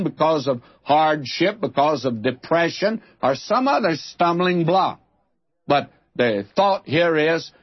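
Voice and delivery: normal talking voice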